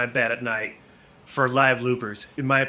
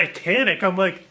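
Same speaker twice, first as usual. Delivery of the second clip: ordinary delivery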